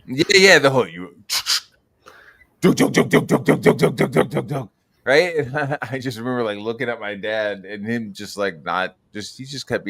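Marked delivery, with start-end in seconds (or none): 2.6-5.4 loud, higher rapid dunk-dunk machine gun noises; imitated gunfire. Retelling the RoboCop boardroom scene to a friend, gunfire noises. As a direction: rattle off the gun sounds like a kid.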